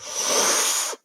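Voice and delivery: sharp breathless mock gasp of outrage; offended gasp. Offended customer at the waiter's joke, mock gasp. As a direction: gasp like he's been insulted. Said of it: "the guy was like".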